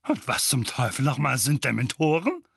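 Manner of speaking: loud, indignant; angry demand. A narrator's reading. Uncle Vernon demands answers, angry. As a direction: bark the question.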